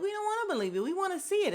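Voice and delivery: high, eager taunting demand; egging on. Retold or imitated. Watching Kandi and Marlo, an eager high dare. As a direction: push for the fight, high and eager. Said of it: "I'm like".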